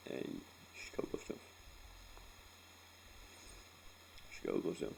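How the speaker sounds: breathy, broken, barely voiced; fighting back real tears; choked up. Real, not acted. Telling the podcast how his mum died, choking up. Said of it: "I hardly ever cry".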